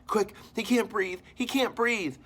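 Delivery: high, loud frantic cry for the nurse; panicked call for help. Acted. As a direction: yell it in a panic, running for help. Running to fetch the nurse.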